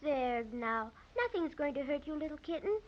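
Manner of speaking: soft, gentle reassurance; soothing. Acted. Girl quietly reassures the frightened kitten. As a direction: hush the scared kitten.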